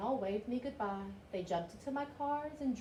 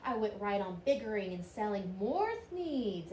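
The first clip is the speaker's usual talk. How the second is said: ordinary delivery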